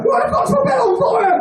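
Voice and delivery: very high, outraged yell; shrieked outrage. Retold or imitated. Flatmate shrieking at the narrator over his burning bed. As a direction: scream the question in disbelief.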